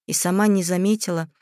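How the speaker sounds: ordinary delivery, nothing marked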